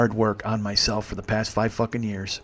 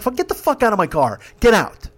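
high, forceful demand ending louder; retold angry order; 9 semitones higher, 4 dB louder than usual. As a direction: re-enact throwing someone out. Teller throws passenger out, retold.

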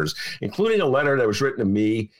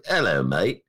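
mock British accent, pitched lower; 6 semitones lower than usual. Shown as a demonstration. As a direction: put on a quick, hammy British accent. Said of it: "I could do a British accent".

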